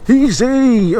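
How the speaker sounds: high, loud "Easy!"; triumphant shout. Real, not acted. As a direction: crow it out in triumph. Triumphant cry climbing hill.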